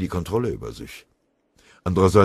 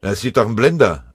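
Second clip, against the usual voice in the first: loud, higher angry retort; 5 semitones higher, 9 dB louder than usual. Acted. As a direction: snap back in a temper. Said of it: "antwortete Scharf wütend".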